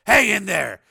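loud, high-pitched pep talk; emphatic reassurance; urgent encouragement. Acted. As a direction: buck her up firmly. Steele encourages scared Sheila.

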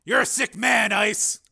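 high, loud jibe; shouted retort. A narrator's reading. Steele teasing Ice on the truck, high shout. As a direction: yell it back over the engine.

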